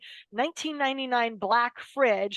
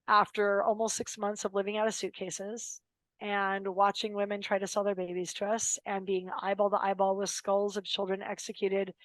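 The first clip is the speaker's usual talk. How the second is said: normal talking voice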